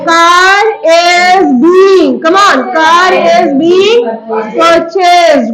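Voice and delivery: drawn out, slow sing-song; very slow dictation. Shown as a demonstration. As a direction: stretch it out for the class to repeat. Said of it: "teacher dictating for class".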